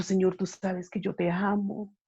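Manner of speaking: normal talking voice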